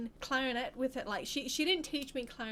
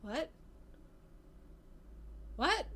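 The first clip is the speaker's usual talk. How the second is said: stunned disbelief; 4 semitones higher, 4 dB quieter than usual. Retold or imitated. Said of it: "I just sat there like".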